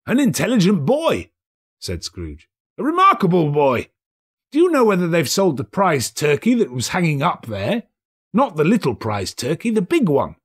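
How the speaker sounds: put-on asking the boy urgently; cheerful old man; eager Scrooge. Scrooge voiced.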